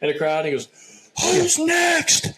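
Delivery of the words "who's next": high, loud impatient shout, imitating the president; mock shout. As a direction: shout into the mic like an impatient old man. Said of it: "he yells into the mic".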